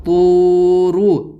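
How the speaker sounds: teacher blends "طورو" slowly; joined word, drawn out. Shown as a demonstration. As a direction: stretch "طو" then add a short "رو". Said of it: "یہاں بھی یہی قاعدہ ہے".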